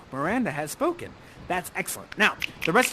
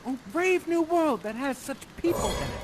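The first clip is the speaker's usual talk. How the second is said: higher-pitched, lightly acted wonder; Miranda's wonder; 6 semitones higher than usual. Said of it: "You have a wonderful line".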